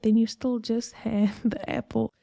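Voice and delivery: normal talking voice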